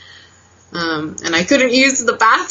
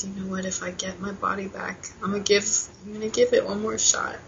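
hushed, emotional resolve; 8 dB quieter than usual. Real, not acted. Patient recalling her vow after the hospital, softly emotional. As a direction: speak softly, really moved. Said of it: "I'm sorry, I get, like, really emotional".

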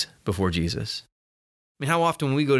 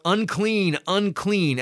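leper crying out; 6 semitones higher, much slower than usual. Retold or imitated. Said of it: "And he should cry out".

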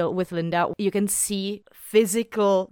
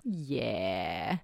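a soft breathy yeah with no voice; hushed agreement; whisper; 11 semitones lower, 11 dB quieter than usual. Real, not acted.

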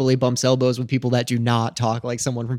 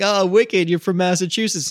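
mock excited; 6 semitones higher than usual. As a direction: exclaim as if catching him out. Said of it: "people would be like".

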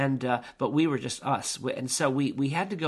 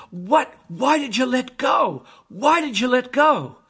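high, loud acted outcry; anguished demand; 10 semitones higher, 8 dB louder than usual. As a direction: demand an answer from your partner. Climber confronts partner who let go.

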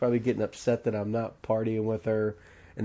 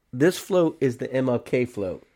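ordinary delivery

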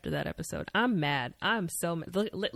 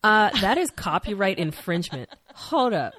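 mock-indignant stop; playful loud protest; mock outrage; 3 semitones higher, 8 dB louder than usual.

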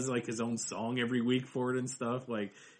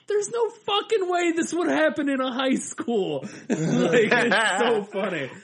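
high, loud, laughing mockery; 12 semitones higher, 5 dB louder, slower than usual. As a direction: scoff through laughter. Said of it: "tear it down and, like".

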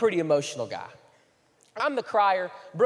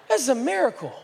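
mock awe; 6 semitones higher, 4 dB louder, faster than usual. Amazed at the calm mom.

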